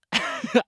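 short high laugh breaking through; burst of laughter. Real, not acted. Losing it over the headphones, a quick high laugh. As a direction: crack up for a second. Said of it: "I can't say it without laughing".

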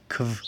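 "kv-" as k run into German w; Q sound. Shown as a demonstration. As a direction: say "kv-" as k plus v, no vowel. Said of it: "se pronuncia como K W". («kv-», una k unida a la v)

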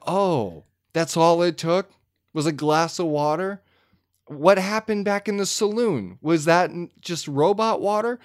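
high, incredulous questioning; mock bafflement. Acted baffled reaction to the scene.

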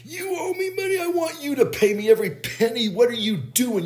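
high-pitched screeching ex-wife; mock screaming. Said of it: "she starts screaming at him".

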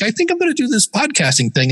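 high, excited plan; eager announcement. Retold or imitated. Tells his fiance his podcasting plan, high and eager.

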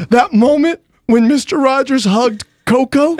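high-pitched, hammed-up weepy imitation; mock choked-up. Mimicking a coworker recalling the movie, mock tearful. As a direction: tell it as if overcome with emotion. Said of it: "he could barely get it out".

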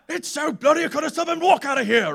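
high, loud mock chef tirade; Gordon Ramsay rant. Imitating the TV chef throwing people out, loud rant. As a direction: shout it as an angry TV chef impression. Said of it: "Kitchen Nightmares with Gordon Ramsay".

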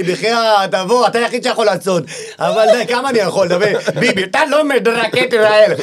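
half-hammed quote of a begging politician; mock Muli. Comedian retelling Muli's call, lightly voiced plea. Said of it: "מולי כל היום על הקו, אומר לי".

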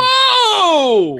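loud high whoa of alarm; shocked shout. Real, not acted. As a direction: yell it out in alarm. Reacts to offensive symbol.

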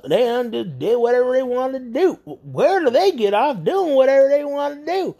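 high, aggrieved whining caller; mock a man's tantrum, rising and whiny; high-pitched indignant caller ranting about his money; whiny mantrum. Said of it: "his mantrum was just all focused on".

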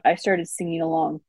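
ordinary delivery

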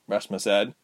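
plain reading voice

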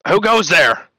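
loud wary call into the dark; called out challenge. Acted. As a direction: call out to a stranger. Guard calls into the dark.

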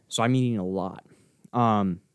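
ordinary talk

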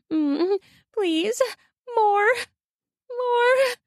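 high, drawn-out pleading slur; slurred begging. A narrator's reading. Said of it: "she slurs".

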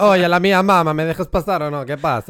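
loud, high hammed-up Italian accent; mock Italian accent. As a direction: shout it like a caricature Italian. Imitating Italian coworker at turnstile.

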